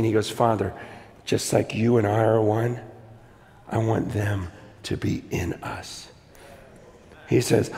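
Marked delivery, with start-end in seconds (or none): 0.3-6.1 low, soft prayer to the Father; quiet prayer. Retold or imitated. Preacher voicing Jesus praying to his Father, quiet prayer. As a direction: pray it gently, phrase by phrase, voice dropped. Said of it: "you see Jesus pray".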